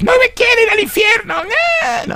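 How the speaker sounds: high, shrill mock scream. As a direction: wail like a girl afraid of hell. (grito agudo burlón)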